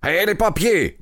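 high-pitched urgent demand; king's growled demand. A narrator's reading. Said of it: "gronda le roi". (question grondée, voix montée dans l'aigu)

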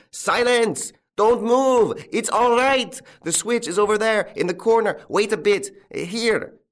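high, loud shout over an uproar; commanding shout. A narrator's reading. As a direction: shout orders across a panicking crowd.

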